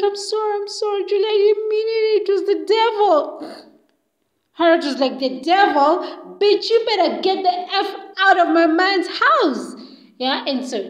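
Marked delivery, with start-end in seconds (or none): 0.0-3.7 high, whining acted plea; crying and begging. Julie dragged to the door, crying and begging. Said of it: "Julie was crying and begging". 5.2-9.7 loud, rising furious order; angry outburst. Retold or imitated. Harriet ordering Julie out, loud and furious. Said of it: "screaming and insulting them".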